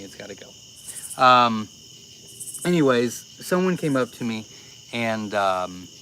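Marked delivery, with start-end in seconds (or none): 0.0-1.2 slow hushed words off mic; whispered aside. Real, not acted. Streamer muttering about the spider, slow whisper. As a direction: say it under your breath.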